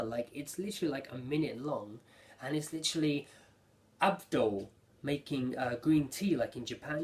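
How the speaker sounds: ordinary talk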